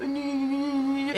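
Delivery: slow high-pitched drone; mock high humming noise; imitated crazy humming. Imitates crazy homeless man's noise.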